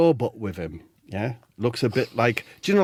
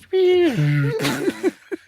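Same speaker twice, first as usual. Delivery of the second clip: high electronic whine; imitated high-pitched machine whirr; broken robot noise; 12 semitones higher, 3 dB louder than usual. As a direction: squeal like a dying robot. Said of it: "You know how it does".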